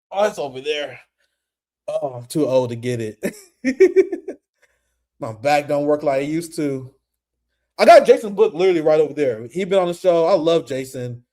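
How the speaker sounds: normal talking voice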